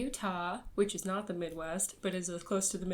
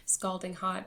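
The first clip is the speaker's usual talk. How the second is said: ordinary delivery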